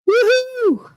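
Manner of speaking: high squealed woohoo; mock-celebrate your clean record; ironic cheer. Real, not acted. Mock celebrating tiny criminal record.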